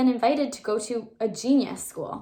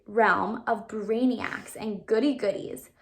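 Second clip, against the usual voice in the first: usual speaking voice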